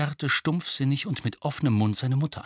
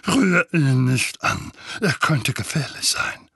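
fearful warning; 2 semitones higher, 4 dB louder than usual. Acted. Vernon fears the letter.

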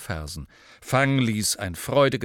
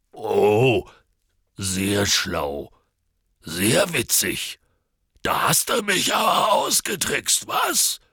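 sarcastic giant; 4 dB louder, slower than usual. A narrator's reading. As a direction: sneer slowly, then rise to an indignant peak. Hagrid sarcastically concedes the trick.

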